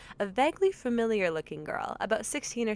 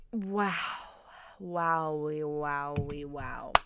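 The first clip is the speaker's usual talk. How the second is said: stunned; 5 semitones lower, 5 dB quieter, much slower than usual. Real, not acted. Stunned reaction.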